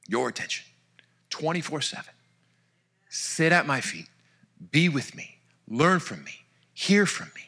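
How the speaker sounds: a hushed tender Jesus calling you close. Acted. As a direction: speak as Jesus, gently inviting. Voices Jesus speaking.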